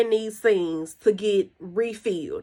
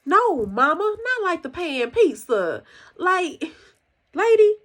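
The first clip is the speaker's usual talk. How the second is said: acted-out exasperation, pitched up; exasperated daughter; 8 semitones higher than usual. Daughter correcting her confused mom, exasperated. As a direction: correct a clueless mom, high and emphatic.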